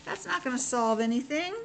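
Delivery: ordinary delivery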